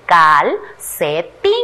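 low, very slow "calcetín": cal, ce, tín; separado en sílabas. Shown as a demonstration. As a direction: sound out "calcetín" one syllable at a time. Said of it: "luego vamos a separar en sílabas". ("calcetín" grave y lento, con pausas entre sílabas)

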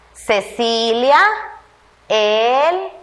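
slow words read one at a time. Shown as a demonstration. Teacher reading the next sentence to the class word by word. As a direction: read each word out slowly and separately. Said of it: "Vamos entonces y leamos".

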